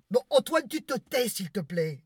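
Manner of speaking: loud, high snap at the husband; sharp rebuke. Acted. Wife loudly silencing husband Antoine, irritated. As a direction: cut him off, loud and high.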